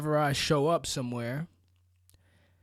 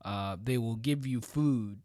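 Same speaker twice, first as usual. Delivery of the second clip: ordinary talk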